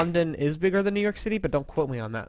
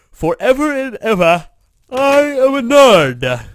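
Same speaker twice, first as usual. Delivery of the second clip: loud, high, drawn-out declaration; mock proclamation; 10 semitones higher, 13 dB louder, slower than usual. Gamer telling the whole world he is a nerd, mock-grand shout. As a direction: belt it out for the whole world to hear.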